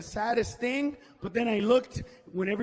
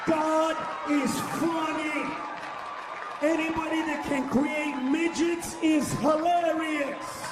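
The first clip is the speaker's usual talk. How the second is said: shouted punchline; 4 semitones higher, slower than usual. Real, not acted. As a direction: proclaim it to the room.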